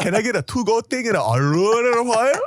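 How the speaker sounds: high mock Korean-accented request; put-on Korean accent. Asking for a to-go box, mock Korean accent. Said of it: "say with a Korean accent".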